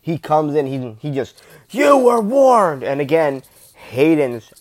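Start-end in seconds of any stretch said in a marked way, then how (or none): 1.7-2.8 loud, high, drawn-out villain shout; Vader. Acted. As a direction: bellow it slowly like an enraged Sith Lord. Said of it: "Vader's just, like, he is storming".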